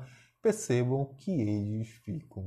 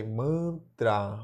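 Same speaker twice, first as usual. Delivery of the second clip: "mantra" as two slow beats, man... tra; syllable by syllable; 2 semitones lower than usual. Shown as a demonstration. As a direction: put a clear break inside "mantra". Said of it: "Vamos fazer a separação silaba".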